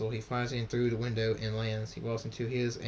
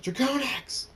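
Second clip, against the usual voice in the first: high, loud yell of a name; drawn-out angry shout; furious scream; 14 semitones higher, 5 dB louder than usual. A narrator's reading. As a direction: scream out for your enemy. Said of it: "He screams".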